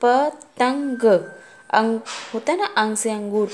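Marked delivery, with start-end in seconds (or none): 0.0-1.0 drawn out very slowly, sounding each part; slowly sounded out; slow spelling out. Shown as a demonstration. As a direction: stretch the word पतंग out slowly for a child. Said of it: "पा में अंग की मात्रा".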